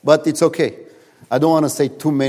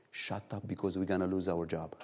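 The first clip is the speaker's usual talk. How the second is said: hushed reply; 5 semitones lower, 11 dB quieter than usual. Retold or imitated. Tiger hushes him quietly.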